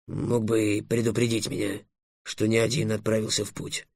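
low, very soft grumble; muttering dragon. A narrator's reading. Dragon reproaching Trix for his hidden companion, muttering. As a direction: mumble it under your breath. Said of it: "пробормотал дракон".

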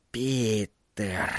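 soft, low exhaled name; hushed, falling exhalation. A narrator's reading. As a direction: breathe the name out quietly. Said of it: "выдохнул Флэг".

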